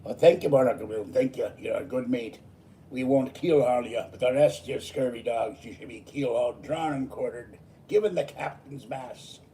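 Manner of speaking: loud, hearty pirate captain growl. Acted. Thanking Barnacle Bill and threatening the scurvy dogs, loud pirate voice. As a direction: play a rowdy pirate thanking his crew. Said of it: "Talking like a pirate".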